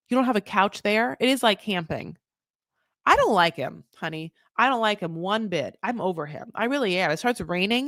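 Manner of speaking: ordinary delivery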